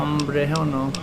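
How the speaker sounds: ordinary delivery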